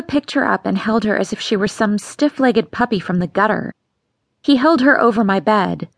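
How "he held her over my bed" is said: ordinary delivery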